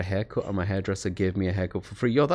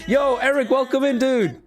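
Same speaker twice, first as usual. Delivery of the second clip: excited greeting; 14 semitones higher than usual. Real, not acted. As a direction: hype up the new subscriber.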